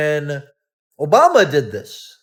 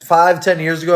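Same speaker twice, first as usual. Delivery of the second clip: usual speaking voice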